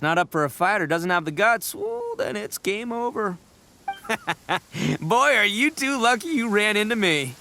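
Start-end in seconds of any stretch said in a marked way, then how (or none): 3.9-4.9 short self-satisfied laugh; smug chuckle. Acted. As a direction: chuckle at your own wisdom. Boasting about beetle expertise.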